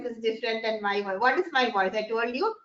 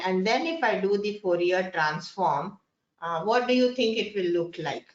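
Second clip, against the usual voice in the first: ordinary delivery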